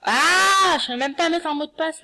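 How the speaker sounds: high startled exclamation, fast; surprised. Real, not acted. Can't set a password.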